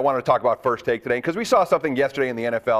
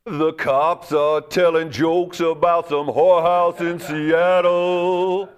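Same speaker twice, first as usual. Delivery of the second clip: slow gravelly growling singer; 3 semitones higher, much slower than usual. Acted. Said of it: "that's my [name] impression".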